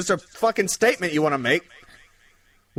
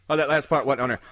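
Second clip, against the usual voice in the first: normal talking voice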